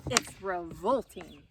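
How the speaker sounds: slow, disgusted father goat. Acted. Father goat recoils at his son's food choices, disgusted. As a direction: drag it out in disgust.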